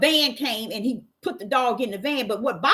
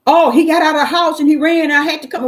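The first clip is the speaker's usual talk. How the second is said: neighbor's excuse; 6 semitones higher, 8 dB louder than usual. Retold or imitated.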